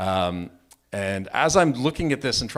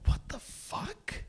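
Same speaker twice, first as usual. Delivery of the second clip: high, hushed, drawn-out incredulity; acted disbelief; 6 dB quieter, much slower than usual. Storyteller reacting to sudden punches, acted stunned disbelief. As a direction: stretch it out softly in stunned disbelief, pitched way up. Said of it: "my reaction is like".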